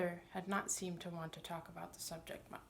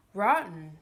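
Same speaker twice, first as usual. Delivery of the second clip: puzzled; 12 dB louder than usual. A narrator's reading. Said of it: "with a puzzled look".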